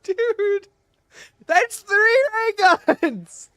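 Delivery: high loud disbelieving shout; excited shouting. Real, not acted. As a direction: yell out in stunned excitement. Three ray guns same box.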